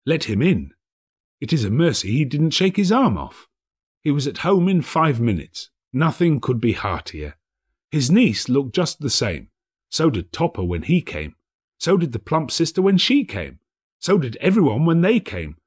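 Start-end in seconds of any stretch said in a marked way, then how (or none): none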